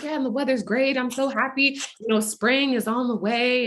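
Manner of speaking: mildly upbeat retold chatter; happy stranger. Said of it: "And she's like".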